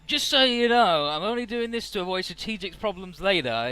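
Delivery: a hammed-up proud ally. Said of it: "he's like".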